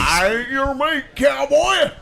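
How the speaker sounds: high-pitched put-on cowboy challenge; mock offended cowboy. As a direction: play an affronted cowboy, voice pushed way up. Joking impression of offended Texan.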